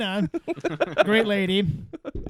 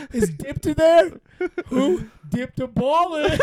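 higher, laughing mock prank-call voice; prank caller; 6 semitones higher, 3 dB louder, slower than usual. Riffing a prank phone call, higher laughing caller voice. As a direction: play the prank caller asking for a name.

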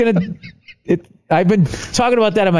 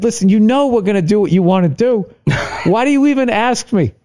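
higher-pitched plea breaking into a laugh; laughing exasperation; 8 semitones higher than usual. Retold or imitated. Husband giving in to wife's pointless question, laughing exasperation. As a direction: retell giving up, laughing through the protest. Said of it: "I just kept laughing".

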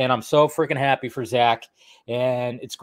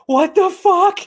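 shocked quote; 4 dB louder, slower than usual. Retold or imitated. Retold shocked reaction mid-stream.

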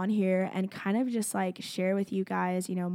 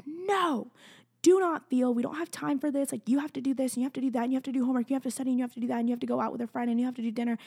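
high, insistent mock scolding, running into a list; bossy self-scolding; 5 semitones higher, much faster than usual. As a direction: imitate a nagging inner taskmaster piling on chores. Acts out her old self-scolding.

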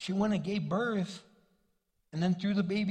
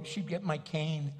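ordinary talk, nothing marked

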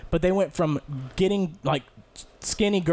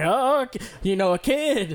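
surprised crowd; 6 semitones higher than usual. Retold or imitated. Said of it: "They're like".

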